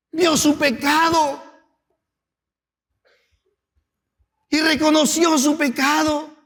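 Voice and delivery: high, loud, impassioned sermon build; preaching at full force. Acted. Pastor rousing the congregation, impassioned loud preaching. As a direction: preach it, rising and pressing the congregation.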